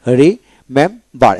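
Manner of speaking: Remember slowly in three separate syllables; split into syllables. Shown as a demonstration. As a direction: break remember into ri, mem, bar with clear gaps. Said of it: "এখানে তিনটা syllable হলো".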